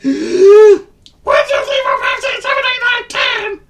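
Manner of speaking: squeaky counting voice. Acted. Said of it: "he said".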